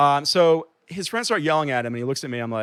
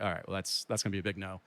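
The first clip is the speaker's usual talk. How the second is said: low, much softer aside to oneself; retold quiet resignation; 7 semitones lower, 14 dB quieter, faster than usual. Man giving up on his iPod after yelling, quiet retold resignation. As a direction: drop right down, as if conceding privately. Gives up after being yelled at.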